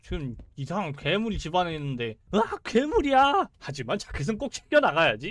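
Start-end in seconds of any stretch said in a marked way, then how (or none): 2.3-5.3 hammed-up panicked game hero; mock game character. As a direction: play a silly horror protagonist. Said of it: "이러고".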